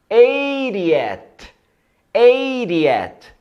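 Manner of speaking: eightieth said high, careful and clear; model pronunciation. Shown as a demonstration. Teacher drilling students, careful model word. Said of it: "Octagésimo".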